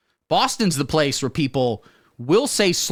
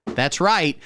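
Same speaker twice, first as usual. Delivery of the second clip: ordinary talk, nothing marked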